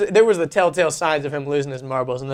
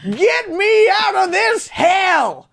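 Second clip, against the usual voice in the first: put-on high, slow, loud old man crying out; old person shout; 4 dB louder, much slower than usual. Grandpa yelling from his bed, high slow shout. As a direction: wail it like a desperate old man. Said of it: "you do an incredible old person".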